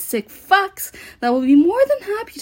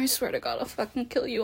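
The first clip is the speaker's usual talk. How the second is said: quiet, lowered, soft menace; 2 semitones lower, 9 dB quieter, faster than usual. Acted. Escalating threat during roleplay.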